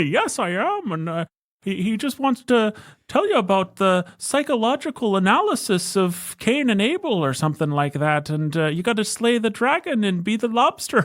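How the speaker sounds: high excited Canadian put-on voice, laughing through it; Jordan Peterson impression. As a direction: gush about lobsters like a giddy professor. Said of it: "Jordan Peterson's on the podcast".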